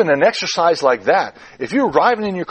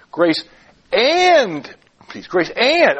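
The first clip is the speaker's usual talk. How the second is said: "and" stretched high between pauses; long, high, emphatic "and"; stress on AND; 5 semitones higher, 3 dB louder, much slower than usual. Shown as a demonstration. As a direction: hold and lift "and", set it apart. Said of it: "By emphasizing different words".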